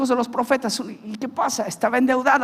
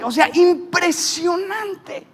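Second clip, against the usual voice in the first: slow, high, amazed exclamation; amazed shout; 7 semitones higher, much slower than usual. Real, not acted. Marvels at the miracle.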